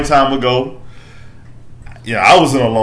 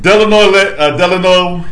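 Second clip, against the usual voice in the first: hype intro; 5 semitones higher, 5 dB louder than usual. Acted. Hype-man intros of podcast guests.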